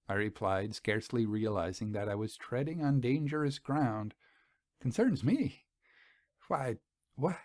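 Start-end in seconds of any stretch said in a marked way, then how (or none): 4.8-7.4 startled rising question, then trailing off; confused surprise. A narrator's reading. Selenitha startled by his hint, confused and halting. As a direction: catch yourself mid-question, flustered. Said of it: "She checked herself in evident confusion".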